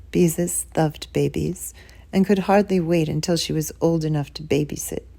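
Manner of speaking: ordinary delivery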